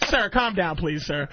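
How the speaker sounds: low, composed crew voice; acted calming plea. Attendant settling a ranting passenger, acted low calm.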